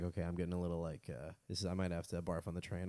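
low, soft, queasy murmur; queasy quiet voice. Retold or imitated. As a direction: sound like you might be sick. Molly voiced feeling sick.

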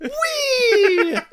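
high, loud squealed whee; gleeful mock "whee". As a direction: let out a long, high wheee like a kid on a slide. Clowning as ridiculous, squeals Whee.

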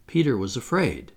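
usual speaking voice

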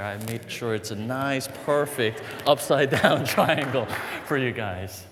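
ordinary talk

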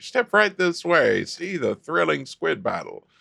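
lightly voiced carnival barker. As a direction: hawk it like a fairground barker. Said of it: "like, a carnival barker out there".

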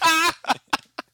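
high, loud cackling laugh; real uncontrollable laughing fit; helpless laughter. Real, not acted. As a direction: burst out in shrieking laughter. Cracking up at the memory.

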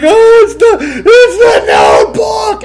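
squealed high and loud through tears, stretched out; high sobbing wife. Retold or imitated. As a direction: imitate the tearful wife wailing the title. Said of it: "she's like".

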